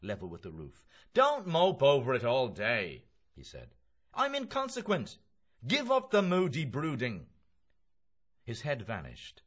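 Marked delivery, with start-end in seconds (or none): 1.1-3.8 loud, high exhortation; hearty raised voice; brisk Mulligan. Acted. Mulligan urges Stephen. 4.1-7.3 high, loud exhortation; hearty raised voice; breezy Mulligan. Acted. Mulligan urges Stephen.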